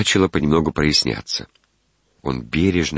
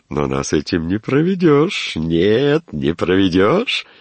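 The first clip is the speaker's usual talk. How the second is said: high, sly, drawn-out ending; knowing, playful lilt; roguish; 3 semitones higher, slower than usual. A narrator's reading. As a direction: gloat slyly that nobody fools you. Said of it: "чрезвычайно плутовское выражение".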